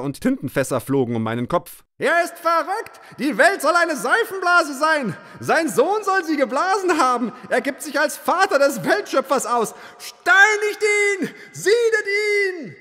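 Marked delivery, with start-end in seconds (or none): 2.0-12.8 furious mob. Acted. Mob calls for stoning.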